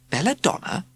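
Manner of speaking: high rising question; curious question. A narrator's reading. Girl asks the teacher curiously, high rise. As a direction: ask it curiously. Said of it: "asked Joanna curiously".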